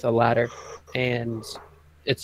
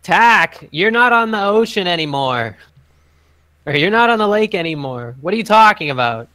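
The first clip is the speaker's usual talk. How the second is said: high, loud, exasperated; a guard scolding the sailor; 7 semitones higher, 8 dB louder than usual. Acted. Guard yells down at Tack, exasperated and loud. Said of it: "He goes".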